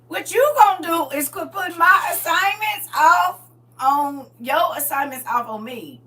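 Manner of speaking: high, loud scolding; exasperated shout. Acted. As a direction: push the assignment back angrily. Scolding God's assignments away.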